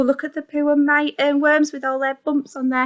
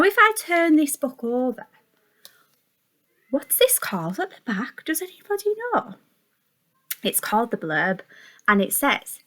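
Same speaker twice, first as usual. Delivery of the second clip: usual speaking voice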